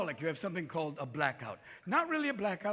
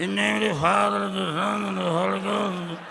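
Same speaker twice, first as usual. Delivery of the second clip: loud, slurred ailing pope; John Paul the Second; 6 dB louder than usual. Acted. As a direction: garble the blessing like a failing old pontiff. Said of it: "Remember John Paul the Second? It was like".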